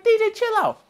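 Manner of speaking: very high, urgent plea to calm down; high pleading. Retold or imitated. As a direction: beg him to stop, pitch soaring.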